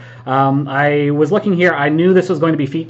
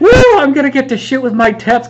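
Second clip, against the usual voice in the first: high, loud burst of glee; happy whoop; 12 semitones higher, 8 dB louder than usual. Retold or imitated. Player recalling his joy at shooting Teps, happy whoop. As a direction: whoop it out, thrilled. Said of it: "I was so happy. I was like".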